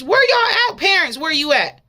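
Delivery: loud, high call to absent parents. Real, not acted. Preacher calling out to parents, loud and high.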